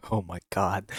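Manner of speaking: usual speaking voice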